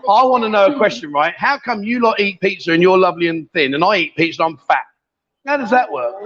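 ordinary delivery

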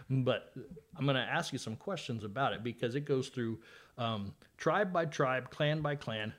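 usual speaking voice

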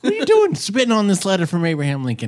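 mock angry rant. Podcast friend retells the film scene, playing a hammed-up angry rant. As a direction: scold her like a fuming bounty hunter. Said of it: "he was like".

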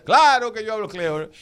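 loud, high, indignant retort; indignant reply. Retold or imitated. Answering the doubter about his French, loud indignant retort. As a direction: insist proudly that of course you speak it. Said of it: "Yo digo".

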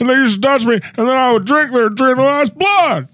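high, comic politician impression; Joe Biden. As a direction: boast in a put-on president's voice. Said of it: "It's your favorite president".

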